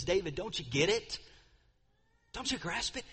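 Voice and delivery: a touch of a pleading, broken-hearted tremble; trembling voice. Retold or imitated. Nathan to his friend David, mildly trembling plea. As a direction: plead gently with a grieving friend. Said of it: "with a trembling, shaky voice".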